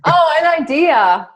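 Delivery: idea drawn out, high and loud; stretched, emphatic Australian "idea". Shown as a demonstration. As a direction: exaggerate idea with no final R, slowly. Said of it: "No, I say idea".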